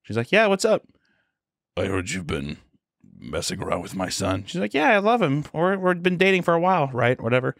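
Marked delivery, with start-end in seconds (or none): 0.3-0.8 light, bright-eyed schoolgirl, pitched up; a carefree student answering her teacher; high teen girl voice. Retold or imitated. Teen girl chirping to her teacher, pitched up. 1.8-4.4 low, soft, threatening murmur; menacing Walter. Retold or imitated. Teacher threatening the student, low and quiet. 4.7-7.2 put-on pitched-up dreamy girlfriend; high teen girl voice. Girl telling teacher she loves his son, high.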